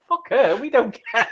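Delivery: high-pitched mock schoolgirl voice, laughing; squeaky send-up breaking into laughter. Schoolgirls dismissing Mary Jane, jokey high voice with a laugh. Voicing schoolgirls' plea, jokingly.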